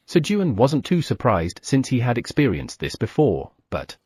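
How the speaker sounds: ordinary delivery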